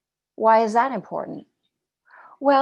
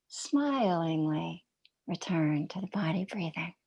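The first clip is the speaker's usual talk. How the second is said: gentle meditation cue; 4 semitones lower, 6 dB quieter than usual. Shown as a demonstration. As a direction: coax the wandering mind back, smiling. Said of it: "we hear the emphasis on that word".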